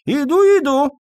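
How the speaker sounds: put-on very high old man's call; high-pitched doddering reply; calling from afar. A narrator's reading. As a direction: sing out from a distant room. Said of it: "раздалось из дальней комнаты".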